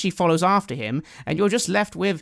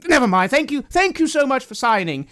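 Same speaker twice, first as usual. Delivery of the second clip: higher, louder rush of gratitude; flustered, grateful priest pitched up; effusive thanks; 8 semitones higher, 5 dB louder than usual. Acted. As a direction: brush it aside and gush thanks. Priest gushes thanks after signature.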